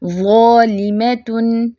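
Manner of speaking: ज़ालिमतुन slow, full za, light rest; only za heavy. Shown as a demonstration. Teacher repeats the correct reading, slow recitation. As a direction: keep ज़ालिमतुन thick only on the za. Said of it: "उसको ही मोटा रखेंगे".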